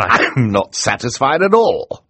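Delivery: high, loud, drawn-out outrage; indignant objection. Acted. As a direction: protest indignantly, stretching the words. Outraged guardian protests the wedding.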